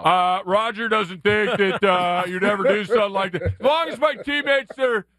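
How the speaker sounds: Roger Clemens impression. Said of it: "Sandblaster of a voice".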